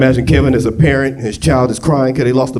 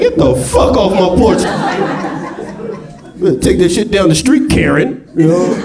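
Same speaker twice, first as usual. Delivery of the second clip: higher, indignant get-off-my-porch yell; mock angry homeowner; 7 semitones higher than usual. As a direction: chase the carolers off the porch.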